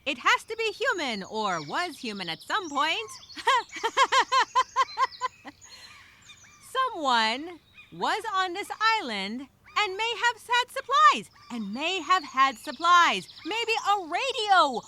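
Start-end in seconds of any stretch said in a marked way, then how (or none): 3.1-5.9 a sudden high burst of laughter; excited laugh. Acted. Survivor finds cloth tied to trees, excited laugh. 6.9-14.8 higher and louder, hopes rising; excited. Acted. Castaway realizing someone else was here, excited. Hope of other people, supplies.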